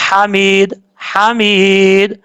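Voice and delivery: حمید with a clear, breathy throat h. Shown as a demonstration. Teacher clearing up the ha for students, careful drawn-out demonstration. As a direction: say حمید with the first h distinct, then draw out the ee. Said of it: "اس ہا کو clear کرنا ہے".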